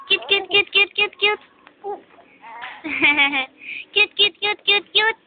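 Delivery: high cooing repetition. Real, not acted. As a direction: coo repeatedly in a high voice. Cooing at baby.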